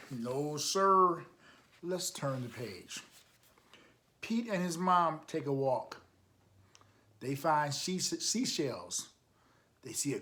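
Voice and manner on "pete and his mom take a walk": usual speaking voice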